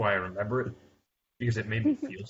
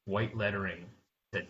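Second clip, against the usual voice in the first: ordinary talk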